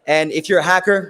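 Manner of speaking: normal talking voice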